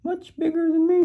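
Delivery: very high tiny goat echo; squeaky little goat. Acted. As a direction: squeak it again, small and high. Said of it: "so he's got a squeaky voice".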